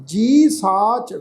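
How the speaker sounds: ਜੀ held long before ਸਾਚ; ਜੀ drawn out, the bihari dwelt on; lengthened ਜੀ. Shown as a demonstration. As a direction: stretch the ਜੀ vowel out slowly. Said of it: "ਬਿਹਾਰੀ ਦੀ ਮਾਤਰਾ ਨੂੰ ਲਮਕਾ ਕੇ".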